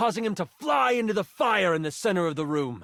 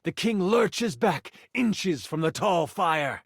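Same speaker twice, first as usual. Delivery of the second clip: ordinary talk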